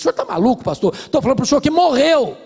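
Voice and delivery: high, loud exasperated outburst; angry wife insisting her marriage is dead; angry retort. Retold or imitated. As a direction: hammer the word "morreu" at him. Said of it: "A mulher ficou muito brava comigo".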